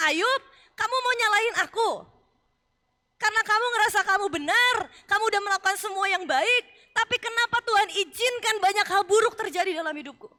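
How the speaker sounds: loud, high, mocking retort; sarcastic God voice. God mocking Job for blaming him, loud and sarcastic.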